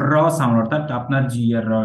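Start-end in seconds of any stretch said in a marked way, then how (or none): 0.1-0.6 র rolled with the tongue tip vibrating; trilled র. Shown as a demonstration. Teacher explaining tongue vibration, trilled র. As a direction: roll out the র before the word. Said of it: "ডগাটা vibration হবে".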